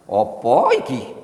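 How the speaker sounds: slow, drawn-out Javanese question; Javanese accent. Shown as a demonstration. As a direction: stretch it like a Javanese uncle. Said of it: "orang Jawa bilang". (logat Jawa yang lambat)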